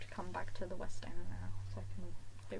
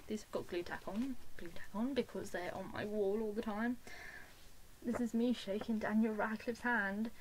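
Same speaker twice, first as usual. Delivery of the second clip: ordinary talk, nothing marked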